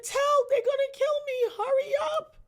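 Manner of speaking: high, loud crying plea; desperate cry. Retold or imitated. Shot in the face, begging her sister to hurry, crying. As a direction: beg for help through tears. Wounded pleading on the phone.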